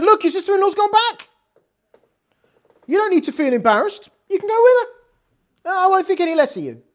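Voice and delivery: a reassuring push to go home; coaxing reassurance. Retold or imitated. Naomi gently urging Rus she may leave. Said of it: "she said".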